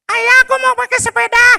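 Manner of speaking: put-on high boy voice calling out, confused; child calling father. Boy Abdi calling his father, high and puzzled. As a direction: shout for dad like a lost little boy.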